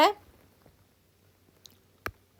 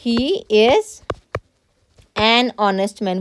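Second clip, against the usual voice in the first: very slow, word by word dictation; teacher dictating a sentence slowly; slow model sentence; much slower than usual. Shown as a demonstration. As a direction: spell it out slowly for the class. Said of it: "sentence भी आप बनाते हो".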